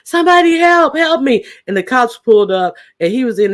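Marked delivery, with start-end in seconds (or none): 0.0-1.5 high, loud mock cry for help; imitated screaming. Parrot heard screaming from the back, high imitated yelling. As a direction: call out like someone in danger, hammed-up. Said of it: "you hear screaming in the back".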